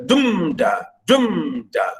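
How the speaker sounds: "dumda" falling from strong "dum" to weak "da"; stress on first beat. Shown as a demonstration. Teacher showing students the trochee, "dumda" stressed on "dum". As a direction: chant "dumda", landing hard on "dum". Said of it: "Stress at the first".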